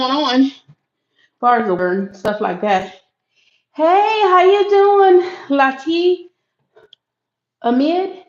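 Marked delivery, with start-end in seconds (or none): 3.7-8.2 high, slow, drawn-out hello; light up greeting a friend; excited greeting. Real, not acted. Greeting a viewer joining live.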